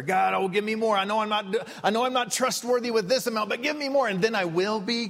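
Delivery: high, whiny begging voice, pitched well up; mock pleading prayer. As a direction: beg God like a greedy child, up high.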